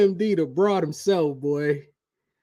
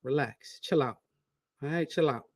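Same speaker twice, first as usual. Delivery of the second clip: lower, softer soothing voice; mild reassuring chill-out; calming his wife; 3 semitones lower, 5 dB quieter than usual. Retold or imitated. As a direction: settle her down gently. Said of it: "I'm like".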